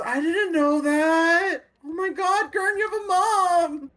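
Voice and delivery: high, slow, amazed cry of wonder; loud excited gasp. Acted. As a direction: squeal it out, eyes wide with amazement. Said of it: "He says that out loud, really loudly".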